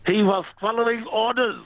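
put-on high-pitched comic German accent; mock German accent. Punchline of German swan joke.

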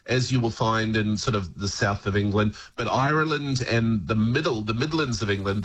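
ordinary talk, nothing marked

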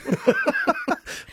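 very high-pitched real cackle; hyena laugh. Real, not acted. Host laughing at own admission, high hyena laugh. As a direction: let out a high hyena giggle. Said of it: "Doing it right now".

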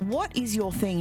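ordinary delivery

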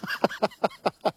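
real high laugh at himself. Real, not acted. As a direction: burst out laughing. Laughs at own chant.

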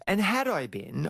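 ordinary talk

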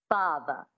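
FATHER ending in a plain uh, no R; dropped R. Shown as a demonstration. Coach modelling transatlantic, father without R. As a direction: let FATHER end on a schwa. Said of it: "you drop the R and you make it a schwa".

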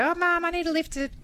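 high mock child's plea; pleading kid. Imitating kids asking Mum for a lift, pleading. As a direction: pitch it up like a kid pestering Mum.

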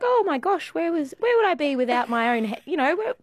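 imitated high-pitched dismay at oneself; flustered surprise. Older lady thanking stranger for spotting forgotten bag, flustered surprise.

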